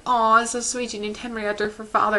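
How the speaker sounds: high, cutesy cooing; mock sweet gushing. Vlogger recapping the sweet second episode, high mock cooing. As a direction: coo it like a sugary aww. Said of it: "it's like".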